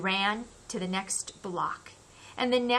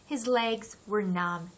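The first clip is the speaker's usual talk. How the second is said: ordinary delivery, nothing marked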